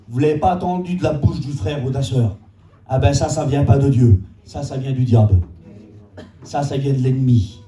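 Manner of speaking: forceful preaching. Real, not acted. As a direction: hammer each verdict home to the congregation. Denounces the enemy's voice. (prédication ferme et martelée)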